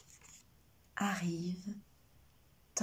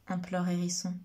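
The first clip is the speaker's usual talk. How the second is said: ordinary delivery, nothing marked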